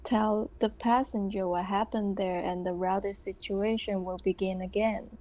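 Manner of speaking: usual speaking voice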